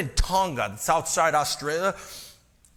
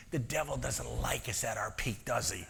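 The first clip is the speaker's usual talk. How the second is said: much softer whispered warning; 7 semitones lower, 10 dB quieter than usual. Real, not acted. Preacher warning his congregation about the devil, whispered. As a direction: share it like a secret.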